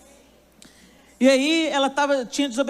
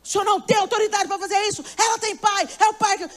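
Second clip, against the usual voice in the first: heated argument; 7 semitones higher than usual. Retold or imitated. Daughter arguing with father over discipline, heated. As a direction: argue hotly with your father. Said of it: "bater boca com meu pai".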